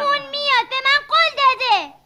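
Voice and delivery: loud, insistent cry of defiance; angry shout. Acted. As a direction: shout it back at the teasing kids. (فریاد معترضانه)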